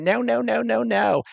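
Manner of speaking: high, loud mocking Karen whine. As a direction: mimic the nagging Karen shrilly and high. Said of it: "You hear the Karen?".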